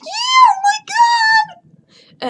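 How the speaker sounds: very high, slow, loud shout of joy; ecstatic shout. Retold or imitated. New keyboard owner shouting with joy, very high and loud. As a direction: scream it like the best gift ever. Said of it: "I was like".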